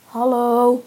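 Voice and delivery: loud answering hail; calling back. A narrator's reading. An unseen passer-by answers the trapped boys, calling back. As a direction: call back so they can hear you. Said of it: "werd er teruggeroepen".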